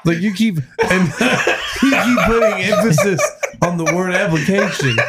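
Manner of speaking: teasing through giggles; giggly ribbing; laughing. Real, not acted. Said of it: "teasing through laughter".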